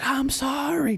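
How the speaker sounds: desperate high yell of sorry. Retold or imitated. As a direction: cry out the apology from the dream. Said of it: "just yelling that I'm sorry".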